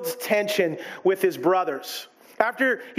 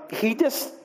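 normal talking voice

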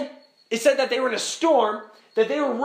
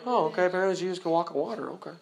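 quick, low, unbothered mimicry; imitating a shrugging, incurious reader; blasé acceptance; 5 semitones lower, 4 dB quieter, much faster than usual. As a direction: breeze through it as if it were nothing. Mimics indifferent reader accepting the verse.

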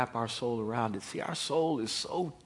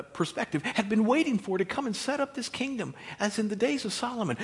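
usual speaking voice

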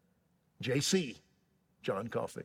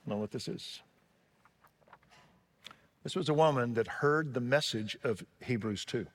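normal talking voice

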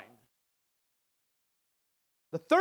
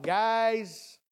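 ordinary delivery

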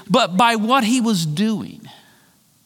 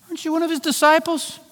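high, quick accusing question; accusing servant girl; 9 semitones higher, faster than usual. Retold or imitated. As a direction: pin him down fast with higher pitch.

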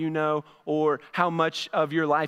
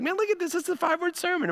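high-pitched mock wonder at himself; replaying his own excitement, much higher; amazed; 9 semitones higher, faster than usual. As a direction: gush like a delighted dad. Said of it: "I was like".

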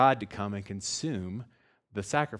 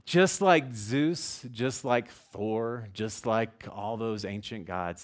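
drawn out; much slower than usual. Real, not acted. Listing pagan gods slowly.

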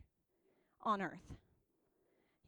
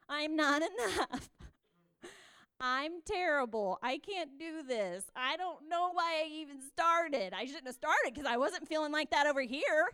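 mock self-doubt; 2 semitones higher, 5 dB louder than usual. Voicing believers' self-doubting inner talk.

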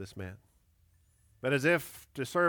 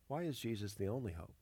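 usual speaking voice

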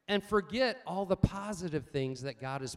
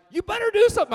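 high, loud, urgent warning from the wife; alarmed shout; 14 dB louder, much faster than usual. Retold or imitated. Wife urging her husband to act, loud alarm.

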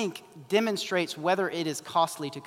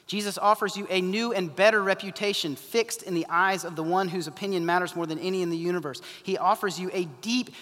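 normal talking voice

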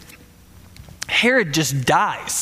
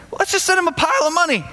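much higher, breezy and quick; mock-casual leaders; 10 semitones higher, much faster than usual. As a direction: toss it off like it's nothing, pitched way up. That's pretty funny, right there.

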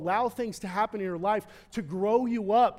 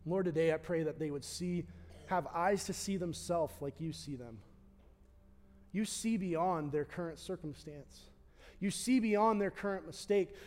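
closing prayer. Acted. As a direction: pray gently over the room.

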